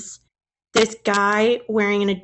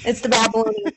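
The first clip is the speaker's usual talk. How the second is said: ordinary talk, nothing marked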